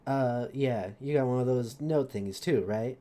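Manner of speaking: low-pitched street urchin character voice; low, offhand character voice; Mikhail. Acted. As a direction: drop the pitch for a casual rogue caught pickpocketing. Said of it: "I like doing my friends' voices".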